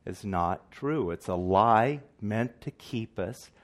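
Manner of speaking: slowly repeated so it sinks in; slow, deliberate. Real, not acted. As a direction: spell it out slowly, word by word. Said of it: "say this a little bit slowly".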